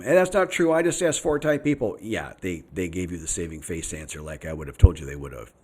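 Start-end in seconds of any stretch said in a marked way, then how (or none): 0.0-2.0 objecting commenter. Retold or imitated. Quoting a viewer who disputes his claim, high-pitched objection. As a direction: mimic an indignant commenter, pitched well up. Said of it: "I've had people on-, comment".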